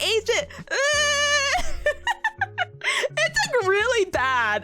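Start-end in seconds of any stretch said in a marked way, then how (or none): 0.5-2.8 high bursting laugh. Real, not acted. Big laugh at chat joke.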